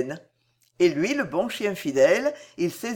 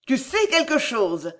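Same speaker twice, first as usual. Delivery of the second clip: higher, hard accusing cry; harsh cry; 6 semitones higher than usual. A narrator's reading. Girl grabbing the boy by the collar, harsh accusing cry. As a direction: shake the truth out of him. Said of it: "cria-t-elle en le secouant rudement".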